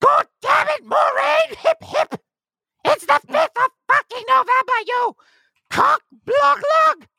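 hammed-up British accent shouted high. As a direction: bellow it in an over-the-top British accent. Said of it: "added a little British accent there at the end".

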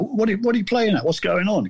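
exasperated mate. Retold or imitated. Mate's puzzled challenge acted out.

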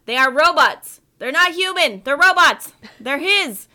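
loud, high mock panic; hammed-up horrified outcry; frantic witness. Frantic witness quote acted out.

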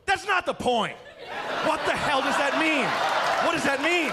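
high, loud, indignant confusion; baffled bar guy. Retold or imitated. Guy on the bus answering her refusal, high and loud. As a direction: push back like the point is obvious. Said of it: "And he goes".

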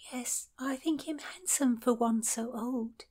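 put-on a blushing girl whispering her answer; whispered confession. A narrator's reading. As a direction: breathe it out shyly. Shy girl's confession, whispered.

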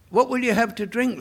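mock-generous host asking what he'll have; Morse offering. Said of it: "Morse says to Lewis".

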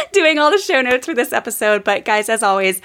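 usual speaking voice

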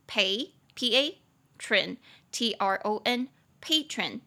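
patron spelled slowly, stress on the first syllable; slow spelling drill of patron; spelled pronunciation. Shown as a demonstration. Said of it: "重音节在第一音节".

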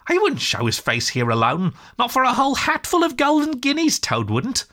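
animated storybook animal, leaping high mid-phrase; amused, scoffing Rat. Acted. Rat mocks Toad's courage to Mole, animated and amused. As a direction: scoff merrily at a friend's bravery.

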